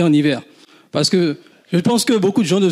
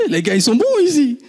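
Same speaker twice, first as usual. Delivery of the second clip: laughing, higher-pitched amazement; 7 semitones higher than usual. Retold or imitated. Student amazed by his classmates, laughing and higher. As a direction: marvel at the classmates through a laugh. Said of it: "Je dis".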